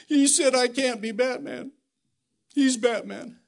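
playing the hurt little boy lightly; tearful little boy. Little Brody complaining to his dad, lightly sobbing. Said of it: "just sobbing and crying".

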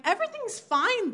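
high, sing-song all's well; mock complacency. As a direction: lift it high, falsely untroubled. Mimicking complacent people.

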